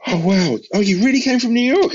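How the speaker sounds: mock-thrilled rock star gushing. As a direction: lift into a giddy, delighted impression of the star. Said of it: "He was like".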